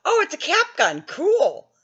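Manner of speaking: put-on gleeful kid finding a toy; excited teen. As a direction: naive teenage delight. Retelling teen self finding gun.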